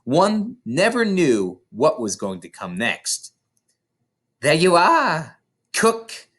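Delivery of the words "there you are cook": high, loud call to the cook; cried out. Acted. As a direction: cry it out triumphantly. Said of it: "the Trunchbull cried".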